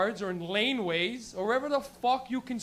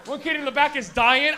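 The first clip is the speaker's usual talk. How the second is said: normal talking voice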